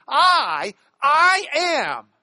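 high, loud I, then a strong I am; emphatic I. Shown as a demonstration. Teacher booming Jesus' words "I, I am". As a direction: proclaim the I like a declaration. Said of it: "the emphasis is Jesus is".

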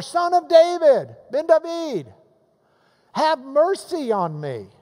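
high, loud insistent call. Retold or imitated. Blind beggar refusing to be hushed, crying out to Jesus. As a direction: raise the voice high and cry out over the crowd. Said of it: "he cries out even more".

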